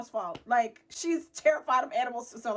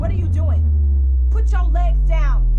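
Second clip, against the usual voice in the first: loud, high, stretched-out orders; yelled command; 4 semitones higher, 15 dB louder than usual. Retold or imitated. Guest ordering legs down as animal jumps, loud yelling.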